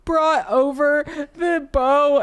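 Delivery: loud, high, drawn-out mock wail; crying child. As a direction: imitate a tearful kid's complaint, slowly. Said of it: "she's, like, crying".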